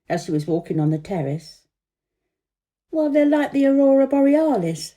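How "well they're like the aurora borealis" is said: higher, louder pompous king; loud, high royal pronouncement; king's voice. Acted. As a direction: boom it out like a self-satisfied king. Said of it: "said the king".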